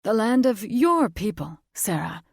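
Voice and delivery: firm claim, falling to her name; narrow-eyed fae father. A narrator's reading.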